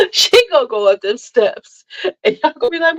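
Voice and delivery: high, cracking-up delivery; laughing through words. Real, not acted. Joking to viewers that her child will vanish, laughing. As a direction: barely get the joke out through laughter.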